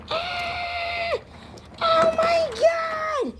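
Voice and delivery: high, frantic screaming; panicked screaming. Acted. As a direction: scream in terror. Panicked train character screaming.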